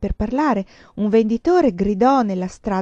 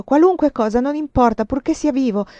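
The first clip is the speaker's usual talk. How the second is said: higher, louder, urgent insistence; desperate relief; 6 semitones higher, 6 dB louder than usual. A narrator's reading. Vinca repeating that only his being alive matters, desperate. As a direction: insist desperately, pitch lifted.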